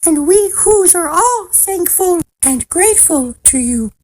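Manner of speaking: high, squeaky little mayor; tiny calling voice. A narrator's reading. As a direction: introduce yourself in a tiny, high voice. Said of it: "called the voice".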